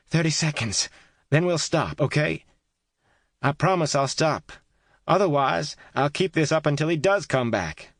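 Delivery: high, loud bargaining; bargaining call. A narrator's reading. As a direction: call out a deal. Kate bargaining, calling out.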